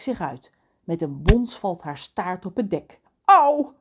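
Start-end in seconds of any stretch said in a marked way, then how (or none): 3.2-3.7 loud, high "ow"; wailing in pain. A narrator's reading. Lorelei's tail thumps on the deck, wailing. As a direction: wail as if your tail hit the deck. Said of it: "jammert ze".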